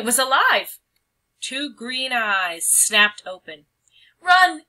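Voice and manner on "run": high, loud cry of alarm. Acted. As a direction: shout it in sudden panic. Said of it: "she cried".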